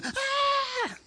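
mock girl scream. Girls scream at snakes.

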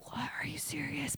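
disbelieving whisper; hushed, voiceless disbelief; stunned team. Acted. Team stunned by coach's decision.

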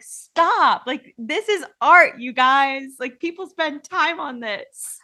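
high, loud, drawn-out plea to stop; exasperated protest. Retold or imitated. Upset speaker retelling her reaction to AI-made film scores, exasperated. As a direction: plead loudly with them, stretching the words. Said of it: "I'm spiraling".

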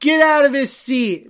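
loud, high, acted-out demand; shouted order. Retelling an order to the seat thief on a bus, shouted. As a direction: order them out at the top of your voice.

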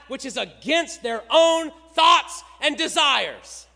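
impassioned sermon, loud and driving; preaching at full force. Acted. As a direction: rail against the church's hypocrisy.

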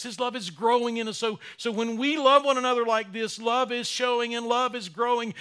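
high and loud, building sermon voice; full force preaching. Real, not acted. Pastor urging his congregation to love, at full force. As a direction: preach it out with rising fire.

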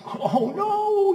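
high, laughing cry of alarm; dad recalling dropping his baby, laughing. Retold or imitated. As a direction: laugh through the remembered panic.

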